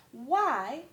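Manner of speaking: plain reading voice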